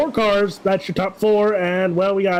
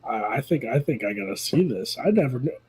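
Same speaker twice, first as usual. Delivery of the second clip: quiet; 7 semitones lower, 5 dB quieter than usual. Real, not acted. Sheepish reply to a tease.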